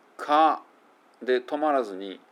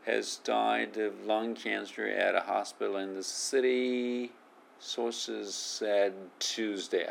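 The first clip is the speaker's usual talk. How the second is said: slowly reading "Has died of lung cancer"; slow model read; 4 dB quieter, much slower than usual. Shown as a demonstration. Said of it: "ここ".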